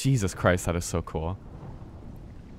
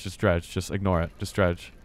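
ordinary talk

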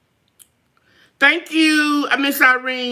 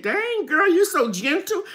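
lightly voiced, higher admiring viewer; Ernie the Hat Maker; 7 semitones higher than usual. Streamer imitating a viewer praising her gentle hands, lightly higher. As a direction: raise the pitch a little, imitating a friend's compliment. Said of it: "I feel like I can hear her voice".

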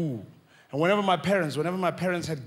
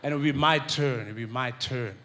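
ordinary talk

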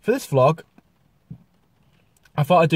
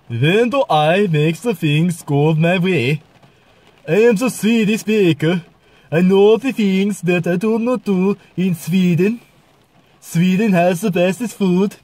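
high, sing-song Swedish voice, drawn out; Swedish accent; 4 semitones higher, 4 dB louder, slower than usual. Shown as a demonstration. Vlogger playing a Swedish baker, slow Swedish accent. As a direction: put on a hammed-up Swedish accent. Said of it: "We go over to Sweden".

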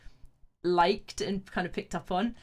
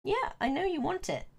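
higher, indulgent pet talk; coaxing a pet; 10 semitones higher than usual. Real, not acted. Streamer tempts the cat with a treat, high cooing voice. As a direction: sweet-talk a cat in a raised voice.

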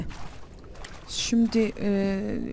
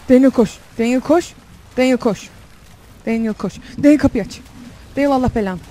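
loud frantic urging at the screen; yelling at the game character in panic; panicked yelling; 8 dB louder than usual. Real, not acted. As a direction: shout at him to run.

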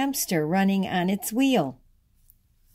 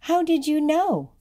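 put-on high-pitched astonished child asking; lifted, wondering storybook voice; surprised question; 9 semitones higher than usual. A narrator's reading. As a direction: ask it in high, amazed wonder. Surprised child asks teacher in story.

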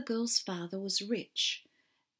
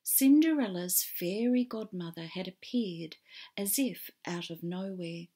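ordinary delivery, nothing marked

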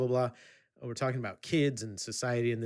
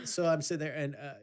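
ordinary delivery

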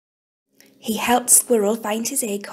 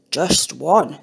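a lightly lowered bear voice, slow and calm; sleepy bear; 5 semitones lower than usual. Acted. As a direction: answer like a big drowsy bear. Bear answers Robin.

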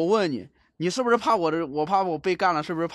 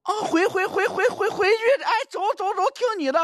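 high, loud repeated cries; shouting to retreat; 13 semitones higher, 3 dB louder than usual. Real, not acted. Streamer dragged back by the ghost, high alarmed shouting. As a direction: yell it out while being dragged back.

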